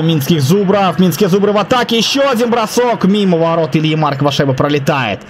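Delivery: ordinary talk, nothing marked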